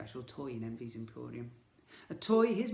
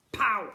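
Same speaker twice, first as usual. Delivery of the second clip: pow; 5 semitones higher, 8 dB louder than usual. Acted. Electric shock throws you.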